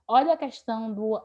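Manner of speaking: ordinary delivery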